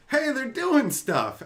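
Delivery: mock delight. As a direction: point it out with glee. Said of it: "I went".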